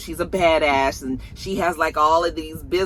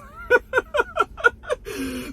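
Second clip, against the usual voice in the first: high laughing fit. Real, not acted. Cracking up at Effie's line.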